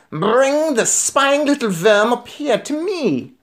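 high, slow witch screech; shouting Grand High Witch. A narrator's reading. Witch leader demanding the boy on the platform, shouting. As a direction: draw the order out in a high witch voice. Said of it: "shouted the Grand High Witch".

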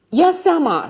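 high, urgent cry of warning; shouted warning. A narrator's reading. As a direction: call out sharply in alarm, voice leaping up. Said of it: "strigă gurista".

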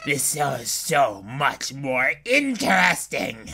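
sly villain sinking to a low hush; menacing villain. Acted. Villain asking what the player will do, quietly menacing. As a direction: toy with the player in a creepy murmur. Voices the taunting flower villain.

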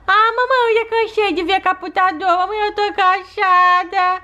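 put-on high, tired little toddler voice; a worn-out child telling mom she's tired; baby doll voice.

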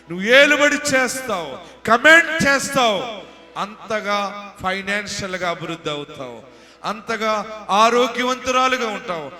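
shouted, high-pitched sermon climax; full-force preaching. Acted. Preacher declares health and prosperity over the congregation, full-force preaching shout. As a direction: thunder the promises out, stretching the big phrases.

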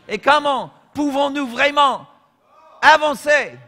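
rising to a high, loud shout on avancer; preacher's full-force outcry; rhetorical shout. Acted. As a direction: cry out the question to the congregation.

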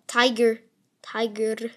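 usual speaking voice

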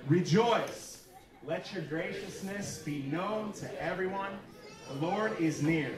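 slow, measured reading of the verses; slow Bible reading from the pulpit; scripture reading. Acted. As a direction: read the passage aloud, unhurried and solemn. Pastor reads Philippians aloud.

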